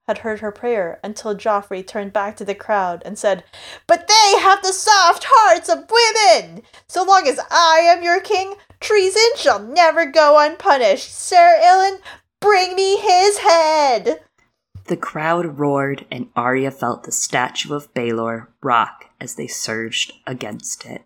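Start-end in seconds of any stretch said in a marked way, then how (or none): 3.9-14.2 put-on louder, higher cruel boy king; roaring tyrant king. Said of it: "a new Joffrey voice". 14.8-21.0 story narration. A narrator's reading.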